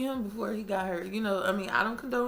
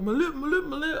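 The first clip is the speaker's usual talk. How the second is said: higher, louder mock ouch; hammed-up pain; mock pained cry; 7 semitones higher, 5 dB louder than usual. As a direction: imitate his burned lip. Imitating burned date.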